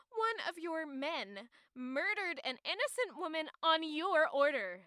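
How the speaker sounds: normal talking voice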